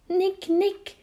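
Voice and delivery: high, pitiful call for help; small, distressed high voice; trembling call. A narrator's reading. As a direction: call out tearfully for a friend. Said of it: "ruft er mit zittriger Stimme".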